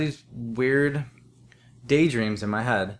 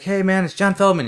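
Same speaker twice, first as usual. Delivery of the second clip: imitating a hyper friend, higher and louder; retelling a hyper late-night call, higher and louder; 9 semitones higher, 7 dB louder than usual. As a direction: bounce it out like an overexcited buddy. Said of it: "He's all hyper and shit".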